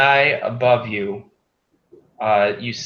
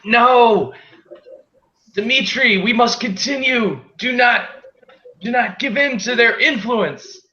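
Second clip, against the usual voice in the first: high, insistent pleading voices; fanatical laborers; 12 semitones higher, 3 dB louder than usual. Acted. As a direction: beg Dimitri to keep going, pitched way up. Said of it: "the other two are like".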